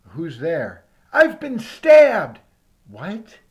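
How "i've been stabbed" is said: slow, loud, higher wail of a stabbing victim; mock dying cry. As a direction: howl it out like you're bleeding on the porch.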